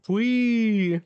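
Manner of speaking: high drawn-out "wee"; imitated stretching noise. Reader mimics Zeus extending, high playful squeal.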